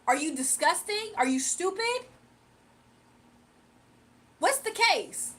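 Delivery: challenging the trolls on her lawn; incredulous challenge. Real, not acted. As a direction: call them out, pitch climbing. Confronting trolls, rhetorical questions.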